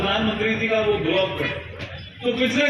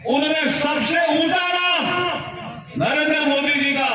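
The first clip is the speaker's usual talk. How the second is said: high, sustained oratorical cry; rallying shout; 6 semitones higher than usual. Real, not acted. Speaker hailing the leader before a crowd, high rallying shout. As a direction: proclaim it to the whole rally.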